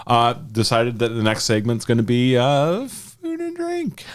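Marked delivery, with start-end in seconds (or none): 2.9-4.0 high, slow showy announcement; drawn-out announcement. Real, not acted. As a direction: stretch it out like a host. Announcing next segment.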